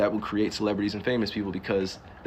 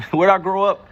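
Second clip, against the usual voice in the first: ordinary delivery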